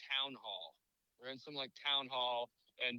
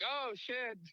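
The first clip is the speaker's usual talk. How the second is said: laughing mock alarm; 7 semitones higher than usual. Looney Tunes' retold reaction.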